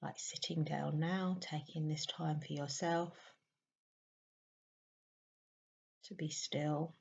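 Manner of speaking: soft meditation voice with long pauses; hushed, spacious meditation guidance; guided meditation. Real, not acted. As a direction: guide a listener gently into stillness, leaving long silences.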